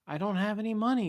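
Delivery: high, loud protest; exasperated plea to a bully; pleading protest. A narrator's reading. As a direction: protest it loudly, pitched well up.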